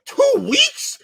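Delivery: high squeaky disbelief; shocked pitch leap on two weeks; incredulous. Retold or imitated. Incredulous retold reaction.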